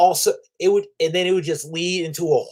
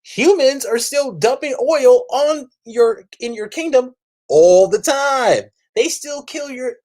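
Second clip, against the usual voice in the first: heated taunt; 10 semitones higher, 3 dB louder than usual. Pretend. Imagined heated taunt at Namor.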